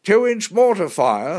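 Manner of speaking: high, loud warning call. A narrator's reading. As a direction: shout the sighting from the cockpit. Pilot calls out enemy fire below.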